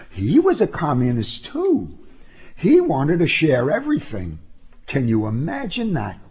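plain reading voice

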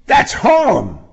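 loud, alarmed protest. A narrator's reading. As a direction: balk at the idea. Alarmed reaction.

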